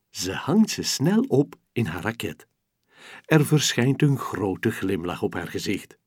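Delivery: usual speaking voice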